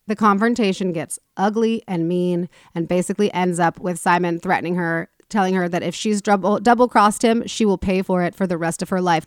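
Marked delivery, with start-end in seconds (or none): none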